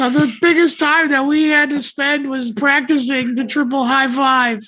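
put-on high, earnest old-timey boy voice; wholesome 1950s kid. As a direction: sound like a squeaky-clean fifties kid. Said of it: "Why is he talking like that".

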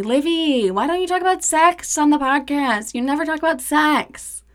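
high, whiny imitation of followers; pestering fans. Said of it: "A lot of you ask me".

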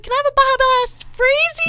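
high cutesy drive-thru order; sweet little ordering voice. Retold or imitated. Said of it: "she adds E onto everything".

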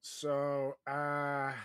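ordinary talk, nothing marked

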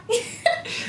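airy laugh after singing; a quick unvoiced giggle; breathy giggle. Real, not acted.

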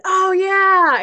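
loud, high sudden recognition; imitating a delighted realization; recognizing oh yeah. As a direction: remember it all at once, delighted. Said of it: "And he went".

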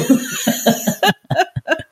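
high-pitched giggling fit; long, high cackling laugh; laughter. Real, not acted. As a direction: laugh helplessly at your own joke. Laughs at her own mattress comparison.

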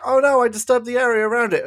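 high, loud, fast hammed-up panic; mock surprise. Mocking the character's too-late realisation, hammed-up surprise. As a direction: fake alarm, played for laughs.

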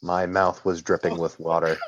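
ordinary delivery

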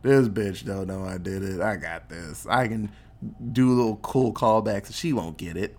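low, hushed mock villain; smug scheming. Villain privately sure she won't catch on, low smug voice. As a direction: think it aloud in a low, cocky undertone.

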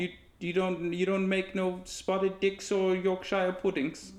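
high, slow mock British voice; British accent. Pressing Leslie about puddings, high put-on British accent. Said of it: "was a British accent".

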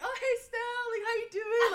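high, chummy mom hello; mock friendly greeting. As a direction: imitate a mom greeting her pal, loud and high. Retold friendly greeting between moms.

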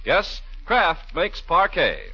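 normal talking voice

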